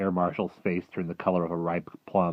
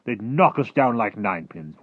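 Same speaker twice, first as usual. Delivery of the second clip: loud, raised-pitch objection; protesting military men; 5 semitones higher, 9 dB louder than usual. Acted. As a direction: object loudly as an alarmed officer.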